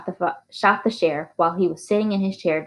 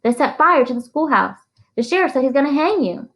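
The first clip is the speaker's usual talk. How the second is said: alarmed; 7 semitones higher than usual. A narrator's reading. Teacher warns Sam of danger.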